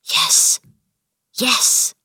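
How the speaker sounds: breathless whispered yes. Acted. Colin promising Mary to keep the secret, whispering. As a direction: barely breathe the words out. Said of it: "Colin almost whispered his answer".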